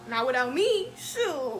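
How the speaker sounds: loud playful objection; loud protest. Retold or imitated. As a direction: call out that you're coming too. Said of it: "I'm like".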